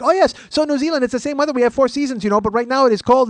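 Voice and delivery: high-pitched relentless weather talk; oblivious chatterbox. Retold or imitated. Passenger rambling despite headphones, oblivious chatterbox. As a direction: prattle on without noticing the hint.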